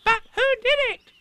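very high, loud, quick squeak; squeaky little child voice; Roo. A narrator's reading. Roo asking Eeyore who did it, high squeak. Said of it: "asked Roo".

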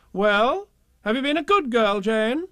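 high, loud sing-song question; mock grown-up sing-song; nagging grown-ups. A narrator's reading. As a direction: nag her in a sing-song.

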